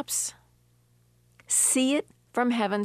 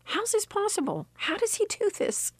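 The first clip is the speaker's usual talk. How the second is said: much higher, awed astonished voice; amazed; 12 semitones higher than usual. Retold or imitated. Widow retelling her awe at God, high and amazed. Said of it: "I immediately turned around and thought".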